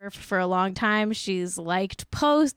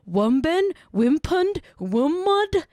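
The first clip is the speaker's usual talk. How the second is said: rising higher on each silly word, mocking; mocking made-up words; 7 semitones higher, 4 dB louder, slower than usual. Quoting Rowling's tweet on podcast, mocking rising squeaks. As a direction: squeak out each fake word for women, climbing in pitch. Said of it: "and she said".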